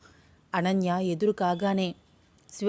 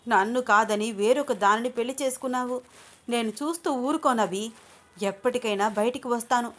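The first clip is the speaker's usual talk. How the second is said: higher, intense voice of a jilted woman; obsessive threat; 4 semitones higher than usual. Acted. Shweta threatening to harm Anu, higher and intense. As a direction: warn him she won't let Anu be.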